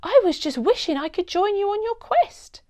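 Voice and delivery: put-on girl pleading to join the superhero; eager girl. A narrator's reading. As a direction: wish out loud like a hopeful child. Child character Seb speaking.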